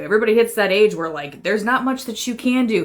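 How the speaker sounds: ordinary talk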